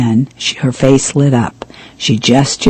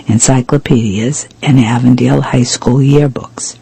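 plain reading voice

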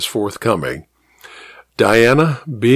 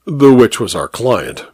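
usual speaking voice

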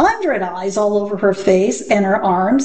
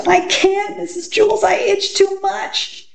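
put-on very high-pitched whiny child; itchy schoolgirl's high whine; whining; 12 semitones higher than usual. A narrator's reading. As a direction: whine it right up high, drawing out the end. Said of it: "Dana whined".

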